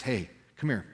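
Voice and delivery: softly, gently drawing her closer; a quiet, tender invitation. Retold or imitated. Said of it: "with all the grace in the world".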